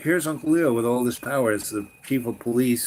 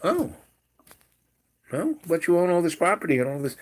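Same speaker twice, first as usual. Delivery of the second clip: put-on surprised kid; 5 semitones higher, faster than usual. Child questioning his great aunt.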